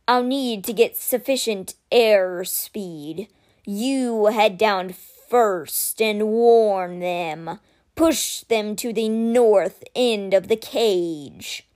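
very slow, weighty creature voice; measured and solemn, a stone guardian speaking; slow guardian statue voice. Acted.

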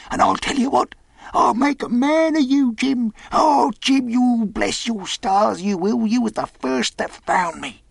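high-pitched, gushing sailor; excited castaway. Acted. Castaway promises to reward Jim for finding him, excited. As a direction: promise it fervently in a high character voice.

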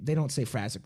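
usual speaking voice

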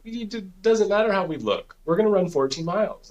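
high, firm insistence; dad insisting to his son they'll run fourteen miles, high and firm; insistent dad. Retold or imitated.

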